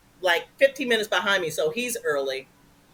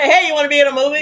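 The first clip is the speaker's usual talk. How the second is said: rapid and loud, higher; fast excited agent; 5 semitones higher, 8 dB louder, much faster than usual. Retold or imitated. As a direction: rattle it off like a pushy agent. Imitating agent calling.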